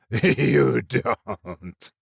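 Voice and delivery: a decayed thing forcing words; hoarse creature taunt. A narrator's reading. Monster speaks in story.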